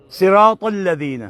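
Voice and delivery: slow careful صراط الذین; slow recitation. Shown as a demonstration. Teacher modelling the verse slowly for the student. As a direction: read صراط الذین slowly, each letter clear. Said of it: "تا کو تھوڑی سی ہے کہ ٹچ دیں".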